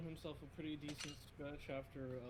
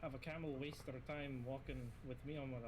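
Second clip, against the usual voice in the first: put-on Russian accent; 10 dB louder than usual. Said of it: "why am I doing a Russian accent".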